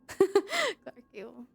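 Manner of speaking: the speaker repeats Clark Gable mockingly, laughing at the name. Shown as a demonstration. Slow amused echo of Clark Gable. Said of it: "That's funny".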